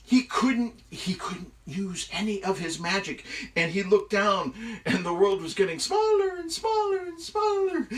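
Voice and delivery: high, loud, breathless-paced storytelling; excited story narration. A narrator's reading. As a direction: tell the ordeal with rising alarm.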